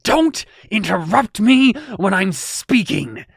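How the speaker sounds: furious slow villain shout; villain's outburst. Acted. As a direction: roar at the hero for interrupting. Villain snaps at interrupting hero.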